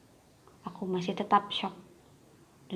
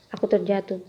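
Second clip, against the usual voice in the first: ordinary delivery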